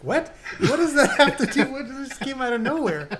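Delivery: baffled and cracking up; laughing disbelief. Retold or imitated. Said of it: "And you're like".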